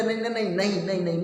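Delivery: acted stutter on repeated refusals; stammering. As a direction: stumble on the first sound, repeating it quickly. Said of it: "हकलाते हुए".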